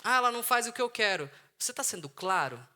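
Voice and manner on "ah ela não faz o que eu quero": whiny complaint. Retold or imitated. Mocking complaining husband.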